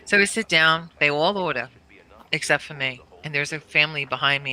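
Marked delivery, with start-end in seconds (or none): none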